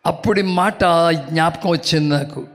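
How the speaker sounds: ordinary talk, nothing marked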